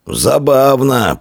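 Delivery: high, long-drawn call; commander's shout. A narrator's reading. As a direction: stretch the word out as a shout. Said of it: "прокричал воевода".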